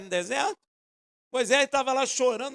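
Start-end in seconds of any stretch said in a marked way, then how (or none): none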